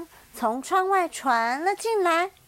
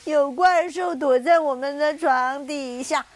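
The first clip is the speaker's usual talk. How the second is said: crying; 7 dB louder than usual. Acted. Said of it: "第三、第四只小企鹅哭着说".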